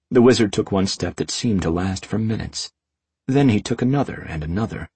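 ordinary delivery

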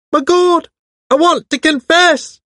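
very high, loud, frantic caller; high-pitched hysterical voice. A narrator's reading. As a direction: shriek it in a strange, strained high pitch. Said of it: "high-pitched, hysterical voice came over the wire".

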